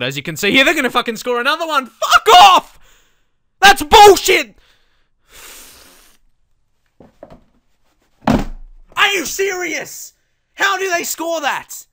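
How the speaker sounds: high, loud, laughing rage at the game; furious outburst. Real, not acted. Gamer raging at his own defense, furious shout. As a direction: explode at the screen in disbelief. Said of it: "sorry for getting really angry".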